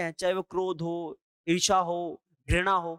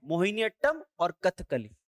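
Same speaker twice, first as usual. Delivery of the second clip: ordinary delivery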